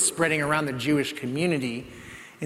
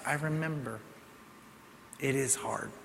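low and much softer; tender echo; 3 semitones lower, 14 dB quieter than usual. Retold or imitated. Preacher voicing the Savior comforting us, low and tender. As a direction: hush it like a comfort to a grieving friend.

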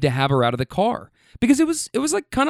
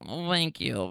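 softer, half-hearted imitation of a drunk girl's nasal "thank you"; 9 dB quieter, slower than usual. Driver imitating a drunk passenger thanking him, in a half-hearted drunk nasal voice. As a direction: try a slurred, nasal "Oh, thank you" and give up. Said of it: "Really drunk, nasally voice".

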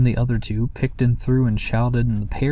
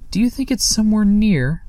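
much higher, nervous question; anxious; 11 semitones higher, 4 dB louder than usual. Acted. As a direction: ask it with growing worry. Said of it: "beginning to get anxious".

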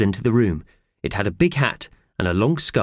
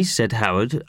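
plain reading voice

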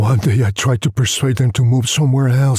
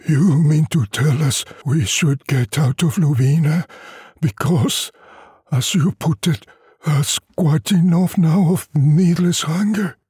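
higher, lightly voiced weary villager, wry; old peasant doubting the schoolmaster, a bit higher; Luvina villagers; 7 semitones higher than usual. Villagers question leaving Luvina.

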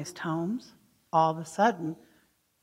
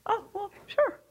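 put-on cheerful child; 13 semitones higher than usual. Child suddenly complies.

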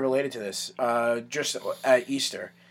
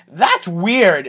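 put-on blunt toddler; 4 semitones higher, 8 dB louder than usual. Toddler's rude verdict, acted out.

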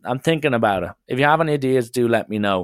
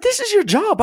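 very high outraged squeal; shrill-free but sky-high indignation; indignant; 4 dB louder than usual. Retold or imitated. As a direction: shoot the voice way up in protest. Arguing with security guy.

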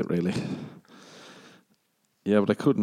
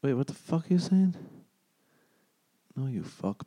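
usual speaking voice

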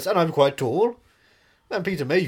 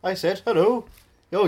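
normal talking voice

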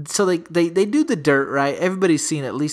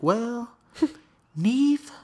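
mom voice; 7 semitones higher than usual. Retold or imitated. Mom voiced greeting Nev.

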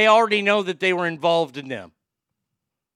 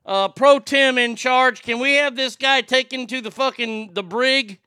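pretend chairman demand; 6 semitones higher, 6 dB louder than usual. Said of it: "I would say".

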